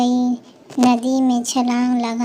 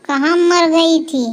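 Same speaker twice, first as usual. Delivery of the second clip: yelling for daughter-in-law; 3 semitones higher than usual. Acted. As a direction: bellow for the bride like a harsh mother-in-law. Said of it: "आवाज़ देने लगी ज़ोर ज़ोर से". (सास का ज़ोर से डाँटते हुए बहू को पुकारना)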